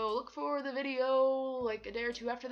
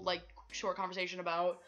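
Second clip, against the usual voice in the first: ordinary talk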